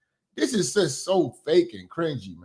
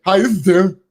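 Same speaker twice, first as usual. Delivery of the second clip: louder mock echo of "Hi, this is Tim"; mocking Tim's greeting; 6 dB louder than usual. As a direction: throw the greeting back, loud and scornful. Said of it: "Who the fuck answers their phone like that?".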